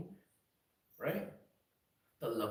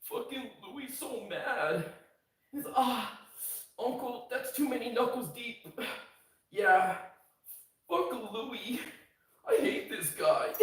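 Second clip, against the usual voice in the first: much higher, slow sneering taunt; mocking a troll viewer; 10 semitones higher, slower than usual. A troll viewer sneers that Louis is mad, high and slow. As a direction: jeer lazily at the streamer. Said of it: "And he's just like".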